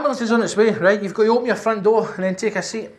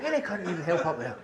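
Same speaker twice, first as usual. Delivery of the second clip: muttered repeat; 7 dB quieter than usual. Retold or imitated. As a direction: mumble it under your breath. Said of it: "He put his head in the door and said".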